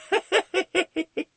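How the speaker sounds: high, manic tittering; crazed boy giggle. Acted. Brainwashed sidekick answers the villain, manic giggling. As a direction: giggle like a deranged child. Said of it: "Joker Junior is like".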